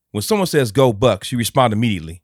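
loud, high imitation of scolding; hammed-up angry order; mock scolding. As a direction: scold her like an irate boyfriend. Man scolding crying girl, retold.

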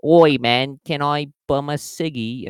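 loud, high mock British tough-guy voice; mock British thug. Russian tough asks stranger for a smoke, mock British. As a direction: bum a smoke like a cocky street tough. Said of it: "he comes up to you and he's like".

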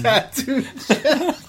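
Tattoo Jack through real laughter, slow and high; can't get the name out for laughing. Real, not acted. Host cracking up at the nickname, laughing.

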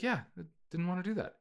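high, quick, small voice; Mario as sheepish son. Acted. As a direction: brush Dad off in a high, hurried voice. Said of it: "have Mario be like".

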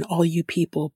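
normal talking voice

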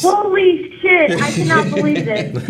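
loud, slow stunned exclamation; excited shout. Real, not acted. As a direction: blurt it out, overwhelmed. Caller stunned to be on air.

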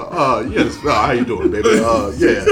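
low, drawn-out radio DJ parody; mock a smooth-talking radio host, slow and hesitant; hammed-up old-school DJ voice; cheap Herb Kent. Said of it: "You sound like a cheap-ass Herb Kent".

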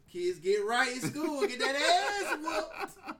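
loud, slow, laughing at the end; slow emphatic punchline. Real, not acted. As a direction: drive the joke home. Closing punchline to kids.

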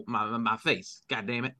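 usual speaking voice

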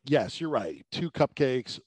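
ordinary talk, nothing marked

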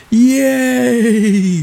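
high loud cheer; cheering crowd. Acted. Crowd cheering Rain's victory, high yay. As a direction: celebrate the win. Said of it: "the people cried out".

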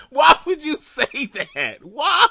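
very high, slow wail through laughter; helplessly cracking up, demand an explanation; squealed, drawn-out protest; laughing disbelief. Real, not acted.

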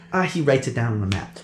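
ordinary talk, nothing marked

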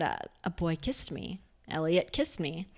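put-on soft, hushed child's admission; a timid girl telling her mom; shy confession. Telling her mom about the kiss, softly and shyly. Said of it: "so I said".

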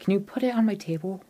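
put-on high, breathy child's voice; little ghost girl. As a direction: speak as a small, shy ghost girl.

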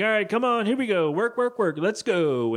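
high, peppy mock-boss voice; rallying boss. New boss arrives late to waiting staff, high rallying voice. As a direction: hurry the staff along, pitched high.

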